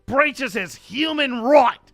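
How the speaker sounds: loud, high, over-the-top Aussie read; effortful, booming Australian newsreader; Australian accent. Acted. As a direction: push the broad Australian accent hard. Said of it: "hear me do my Australian accent".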